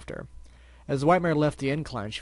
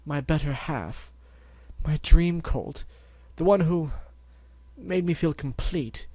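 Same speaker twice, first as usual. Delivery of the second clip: dreamy; 4 semitones higher, 3 dB louder, slower than usual. Acted. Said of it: "her eyes grew distant".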